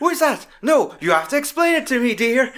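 high mock-outraged demand; going mental. Film dad demanding an explanation, mock-mental. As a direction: imitate an indignant dad, laugh creeping in. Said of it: "he goes fucking mental".

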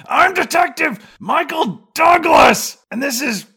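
high, loud hammed-up shout; Michael Douglas impression. Detective introducing himself to Sharon Stone, loud mock shout. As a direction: bellow the introduction like a cartoon cop. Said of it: "Michael Douglas says".

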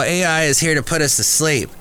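ordinary talk, nothing marked

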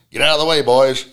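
calling out. Acted. Rider calls out from the bronc.

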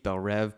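"Rev" with a short e and a final v; Rev pronunciation. Shown as a demonstration. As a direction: say "Rev" to rhyme with Bev. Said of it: "What do you think?".

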